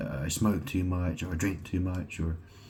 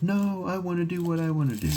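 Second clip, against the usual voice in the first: mocking whiny quote; 11 semitones higher than usual. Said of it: "people are like".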